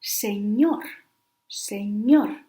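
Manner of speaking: "señor" with the weight on ÑOR; last-syllable stress. Shown as a demonstration. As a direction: land hard on the final syllable of "señor", se-ÑOR. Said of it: "the stress goes in the last syllable".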